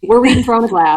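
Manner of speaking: hammed-up Southern accent; badly faked Southern for a show intro; Southern belle attempt. Co-host finishes the podcast intro, mock Southern accent. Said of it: "sounding like a Southern belle".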